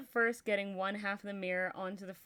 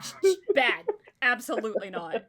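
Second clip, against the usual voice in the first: loud, playful telling-off of a naughty pet; hammed-up stern shushing at the chat; mock scolding; 2 semitones higher, 9 dB louder than usual. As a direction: shush them like a misbehaving cat.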